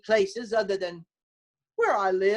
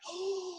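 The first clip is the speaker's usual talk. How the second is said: sharp gasp; 10 semitones higher, 11 dB quieter than usual. Retold or imitated. Realizing who called.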